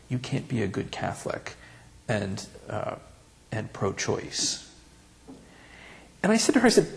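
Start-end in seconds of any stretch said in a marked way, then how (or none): 0.1-4.6 hushed, slow disapproval; very low voice. Retold or imitated. Old lady at Mass quietly scolding him, hushed and slow. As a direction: lean in and murmur it like a secret rebuke. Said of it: "said in a very low voice".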